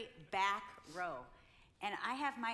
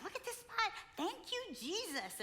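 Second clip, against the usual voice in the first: much higher, delighted squeal of thanks; thrilled at the parking spot; giddy thanks; 12 semitones higher than usual. Retold or imitated. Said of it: "I'm like".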